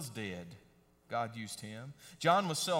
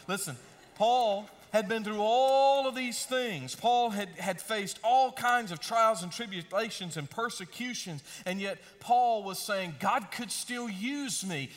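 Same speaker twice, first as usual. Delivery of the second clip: higher and louder, driving the point home; full-force preaching; 5 semitones higher, 4 dB louder than usual. Acted. Preacher to congregation, building to full-force preaching. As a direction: preach it out with rising fire.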